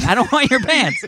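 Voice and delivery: high-pitched indignant retort; mock protest. Host retelling his reply to the ads, mock protest. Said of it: "And I'm like".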